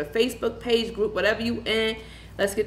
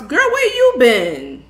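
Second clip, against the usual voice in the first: loud, slow teasing call to a friend; loud playful call-out; 4 semitones higher, 10 dB louder, slower than usual. Real, not acted. Teasing a long-absent viewer.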